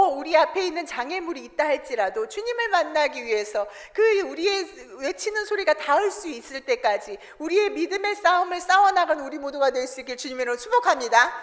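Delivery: ordinary delivery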